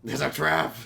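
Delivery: loud alarmed mock warning; Admiral Ackbar impression. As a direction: bark it like Ackbar.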